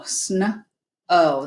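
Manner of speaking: sn and o split apart; sound it out. Shown as a demonstration. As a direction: sound out "sn-o" in two pieces. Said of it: "the O W is the O pattern".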